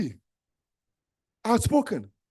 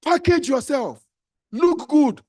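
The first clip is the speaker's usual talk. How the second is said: higher, louder, slow command; loud exhortation; 8 semitones higher, 6 dB louder, slower than usual. Real, not acted. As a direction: urge them up loud. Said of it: "preacher exhortation".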